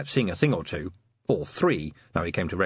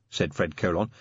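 plain reading voice

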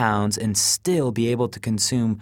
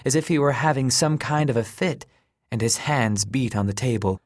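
plain reading voice

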